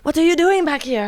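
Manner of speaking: lightly put-on Turkish accent; a hint of a gruff Turkish boss; Turkish accent impression. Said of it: "trying to do it".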